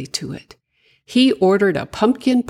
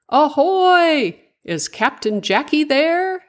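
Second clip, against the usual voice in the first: shouting over; 11 semitones higher than usual. A narrator's reading. Igor shouts over to Jackie's ship from the dock. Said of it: "shouted over to it from the dock".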